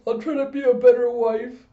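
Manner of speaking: high, loud tearful-sounding mock wail; gushing, melodramatic promise; overcome wife. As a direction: vow it with hammed-up emotion. Wife overcome by husband's praise.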